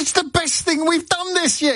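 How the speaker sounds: very high, indignant squeal; pleading in outrage, way up high; exasperated cry. Retold or imitated. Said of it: "I got quite cross".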